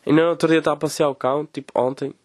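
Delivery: ordinary talk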